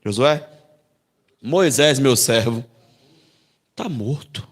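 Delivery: low, plain voice of God; God's voice. Retold or imitated. God telling Josué that Moisés is dead. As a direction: say it low and flat, as bad news. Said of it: "Olha o que Deus chega pra Josué".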